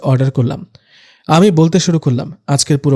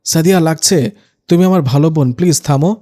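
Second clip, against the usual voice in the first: plain reading voice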